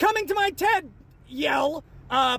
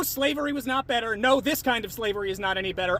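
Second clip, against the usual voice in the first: ordinary talk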